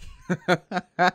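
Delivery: real amused laugh. Real, not acted. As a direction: laugh at the absurd comment. Laughs at the comment.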